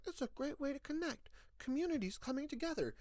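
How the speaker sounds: high, soft, sugary ad-speak; mock corporate pitch. Mocking Facebook's marketing in a sweet ad voice. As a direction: sell it like a fake-sincere tech ad. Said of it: "They're like".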